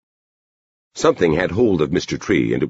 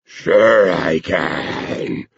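very slow, drawn-out dog voice; talking dog; much slower than usual. A narrator's reading. Dog eagerly answers the girl's bet, slow drawn-out dog voice. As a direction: stretch the words out like an eager hound. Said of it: "the dog said, and whined in eagerness".

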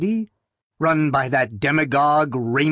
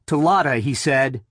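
plain reading voice

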